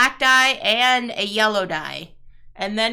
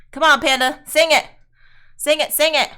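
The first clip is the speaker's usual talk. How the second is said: louder, egging the chat to sing along; urging; 4 semitones higher, 6 dB louder, faster than usual. Real, not acted. Streamer urges Panda to sing along, louder. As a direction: cheer Panda on to join in.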